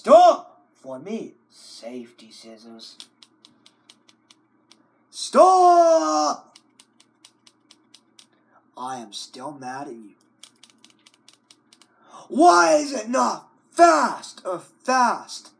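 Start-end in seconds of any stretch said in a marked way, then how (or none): 0.0-0.6 loud high Stop!; mock yell. Yelling at the computer. 5.1-6.6 loud high Stop!; mock yell. Yelling at the computer. 12.3-15.5 loud slow complaint of why is it not fast; frustrated shout. Acted.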